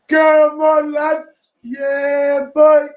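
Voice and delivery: very high, loud triumphant yells; real cheering. Real, not acted. Fan cheering England's win at the screen, high loud yells. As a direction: cheer the lads home.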